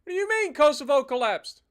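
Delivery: can't believe the news; indignant disbelief. Real, not acted. Game alert, disbelief.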